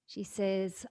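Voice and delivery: usual speaking voice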